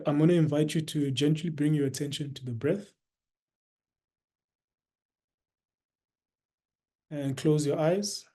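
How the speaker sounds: soft, unhurried meditation guidance with long pauses; guided meditation. Real, not acted.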